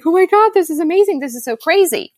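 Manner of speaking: high, fast burst of delighted disbelief; giddy excitement. Retold or imitated. Founder recalls record launch, thrilled and gushing. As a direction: gush over a huge success. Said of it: "which was like".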